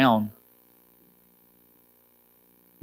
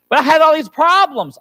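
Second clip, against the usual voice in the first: loud, high mock objection; imitated protest; 14 semitones higher, 6 dB louder than usual. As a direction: play the complaining churchgoer, pitched way up. Mimics a listener's objection.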